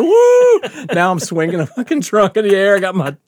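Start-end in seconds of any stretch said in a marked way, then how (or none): 0.0-0.9 high, loud "woo"; retold party whoop; whooping cheer. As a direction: whoop along with the crowd.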